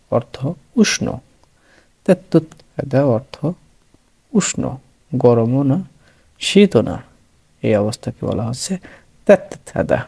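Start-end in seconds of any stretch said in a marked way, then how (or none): none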